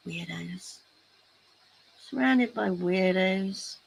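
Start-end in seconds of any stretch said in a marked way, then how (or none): none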